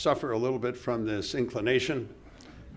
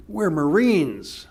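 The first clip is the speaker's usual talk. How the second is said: slow and louder; proud, slow emphasis; 5 semitones higher, 6 dB louder, much slower than usual. Retold or imitated. Charlie tells Walter why nothing was said, slow and proud. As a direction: plant each word with pride.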